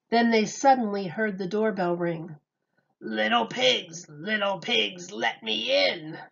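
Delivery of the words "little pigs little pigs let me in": crying wolf. A narrator's reading. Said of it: "cried the starving wolf".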